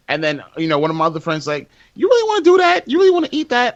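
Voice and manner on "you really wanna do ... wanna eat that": higher, louder mock disbelief; imitated incredulous friend. Friend questioning the tofu order, imitated disbelief. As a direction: question it high-pitched, as a stunned friend.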